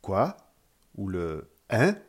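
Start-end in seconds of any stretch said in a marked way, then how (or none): 0.0-0.4 QUOI rising like a question, louder; "quoi" as a loud upward query. Shown as a demonstration. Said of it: "doit monter sans variation, un peu comme". 1.7-2.0 HEIN swept upward, loud; "hein" rising sharply from mid pitch; rising exclamation. Shown as a demonstration.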